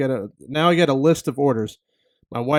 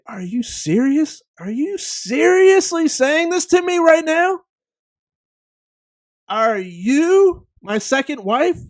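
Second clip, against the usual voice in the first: high, slow, drawn-out disbelief; incredulous outrage; 10 semitones higher, 3 dB louder than usual. Retold or imitated. Driver fumes at the rude cashier, high-pitched and incredulous. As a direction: stretch every word in shocked disbelief. Indignant inner thoughts at cashier.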